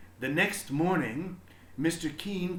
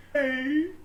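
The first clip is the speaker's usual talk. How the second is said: put-on high, loud child's voice calling; a kid brother protesting from the doorway; little brother calling out; 14 semitones higher, 9 dB louder, slower than usual. A narrator's reading. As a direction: call out like an upset little boy. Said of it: "her brother called".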